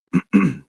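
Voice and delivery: short dry throat-clearing cough; acted cough. Sick wife in the evening air, acted cough. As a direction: cough lightly, as if ill. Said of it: "She coughs".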